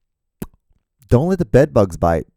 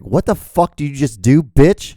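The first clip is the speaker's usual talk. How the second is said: louder, higher mock confrontation; mock hostile glare; 6 semitones higher, 5 dB louder than usual. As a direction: snap like they're offended. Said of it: "They always look at me like".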